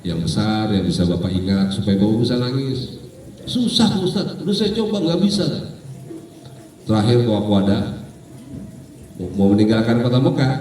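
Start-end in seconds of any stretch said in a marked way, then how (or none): 3.5-5.7 mock helpless protest. Acted pilgrim answers the ustadz, frustrated.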